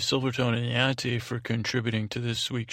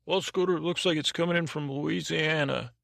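high-pitched put-on sidekick voice; higher, chipper-sounding helper voice; sidekick Bob; 5 semitones higher than usual. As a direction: pitch it up as Bob the call screener. Host voices Bob, his sidekick.

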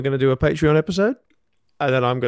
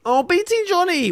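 mocking. Mocking the plea.

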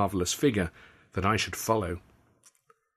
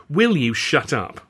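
snapping; 5 semitones higher, 6 dB louder, slower than usual. A narrator's reading. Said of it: "I snapped".

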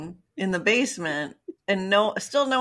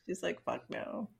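quiet; 2 semitones lower, 13 dB quieter than usual. Retold or imitated. Blunt mock answer.

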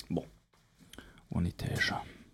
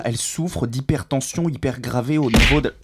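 loud, higher exasperated rant; exasperated yelling; 5 semitones higher, 7 dB louder than usual. Acted. The doctor yelling at the secretary touching the patient, loud. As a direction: shove her off with raised voice.

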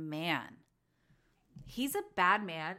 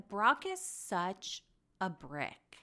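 ordinary talk

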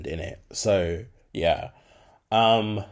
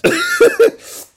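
sudden high laugh burst; high shrieking laugh; 9 dB louder than usual. Real, not acted. Recalling taking it back to the nan, shrieking laugh.

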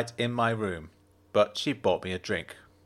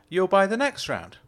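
Jenna's voice; 9 semitones higher than usual. A narrator's reading. Jenna's voice in dialogue.

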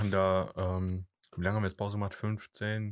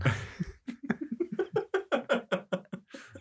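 squealing laugh, pitch way up; high shrieking laugh. Real, not acted. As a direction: shriek with laughter at your own joke. Laughs at own flirty joke.

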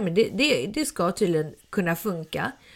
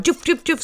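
quick high-pitched zipping noises; three little high whooshing sounds; imitated racing thoughts; 8 semitones higher than usual. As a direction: mimic thoughts shooting past, in higher pitch. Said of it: "Alltså tusen tankar bara".

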